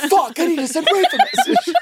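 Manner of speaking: shouted through a wall, laughing; yelling from the next room, muffled by distance; from another room. Retold or imitated. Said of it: "I wanna hear that from the other room".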